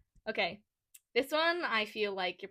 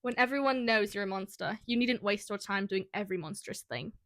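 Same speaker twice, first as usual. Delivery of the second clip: put-on amateur British accent for a quote; measured British-accented quote reading. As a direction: read it out in a modest British accent. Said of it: "do them all in a British accent".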